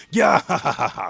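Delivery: loud high maniacal cackle; maniacal laugh. Retold or imitated. Reading the villain's line, maniacal laughter. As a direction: cackle wildly at the fallen. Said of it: "he's laughing maniacally".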